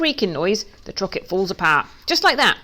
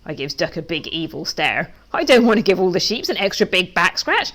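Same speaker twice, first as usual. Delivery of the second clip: usual speaking voice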